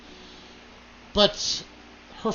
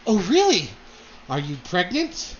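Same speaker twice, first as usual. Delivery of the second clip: shocked and excited; 4 dB louder than usual. Acted. Lady Dahlia to her daughter, shocked and excited. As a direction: play a mother thrilled and taken aback. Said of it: "kind of shocked and excited".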